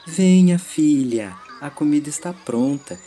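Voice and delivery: loud, high call from afar. Acted. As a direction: call her daughter in from the doorway. Mother calls from the door.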